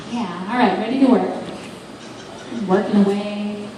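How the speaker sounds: normal talking voice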